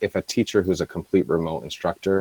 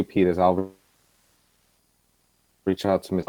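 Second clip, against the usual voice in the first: breaking up. Real, not acted. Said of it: "No, you're breaking up".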